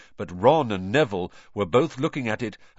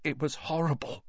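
after a gulp; 6 semitones higher, 6 dB quieter than usual. Acted. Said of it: "he gulped".

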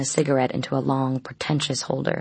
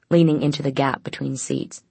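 ordinary delivery

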